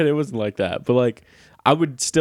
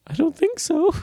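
put-on high childish voice. Kid self refusing church visitors.

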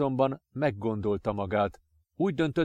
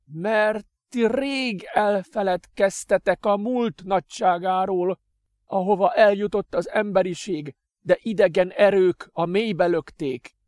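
defiant; 9 semitones higher, 5 dB louder than usual. Acted. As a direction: preach it defiantly. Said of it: "mondta dacosan".

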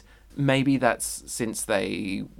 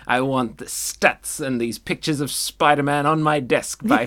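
Jameson; 3 semitones higher, 7 dB louder than usual. Acted. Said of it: "if I was Jameson".

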